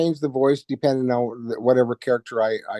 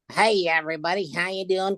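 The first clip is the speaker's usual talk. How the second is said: higher, nasal monkey puppet greeting; nasal orangutan puppet; 7 semitones higher, 3 dB quieter than usual. Shown as a demonstration. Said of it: "kind of a nasal voice".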